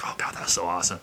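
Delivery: breathless, moved whisper; awed whisper. Retold or imitated. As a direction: whisper it in awe. Whispered awe.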